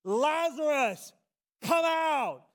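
full-voiced high shout, drawn out; megaphone-loud commanding yell; crying out loudly. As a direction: bellow the summons with all your might. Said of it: "he cried out in a loud voice".

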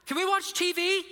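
put-on high, loud child calling from another room; kid calling out. Kids yelling to dad from their room, high loud call. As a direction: holler it high like an impatient kid. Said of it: "I hear from the room".

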